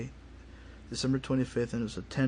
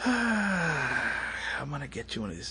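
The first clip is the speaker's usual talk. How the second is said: muttered vow; slower than usual. Retold or imitated. Retold vow to get even.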